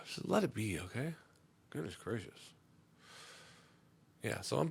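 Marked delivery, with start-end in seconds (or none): none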